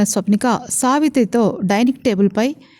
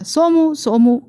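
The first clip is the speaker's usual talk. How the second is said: calling a servant from another room, pitched up; 6 semitones higher, slower than usual. Acted. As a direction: call his name across the house. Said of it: "అని పిలిచింది". (దూరంగా ఉన్నవాడిని పిలుస్తూ)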